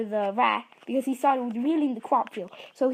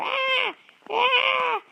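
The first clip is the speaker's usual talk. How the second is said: high shrieking screams; chase screams; 13 semitones higher, 3 dB louder than usual. Acted. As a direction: scream while running away. Chase continues.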